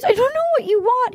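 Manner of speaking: high, whiny tearful imitation; exaggerated tearful whine; mock crying. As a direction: wail it as a mock protest. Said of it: "She starts crying".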